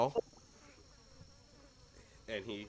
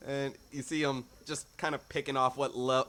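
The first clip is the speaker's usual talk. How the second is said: ordinary delivery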